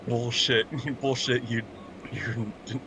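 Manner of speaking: usual speaking voice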